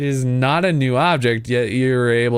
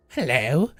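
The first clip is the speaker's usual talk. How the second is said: soft serene polite maiden; 3 dB quieter than usual. Acted. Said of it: "Voice of a priestess".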